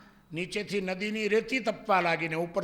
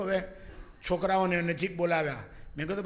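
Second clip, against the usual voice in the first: ordinary talk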